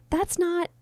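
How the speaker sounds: high-pitched gasped protest; startled and high; Robin's gasp. A narrator's reading. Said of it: "Robin gasps out loud".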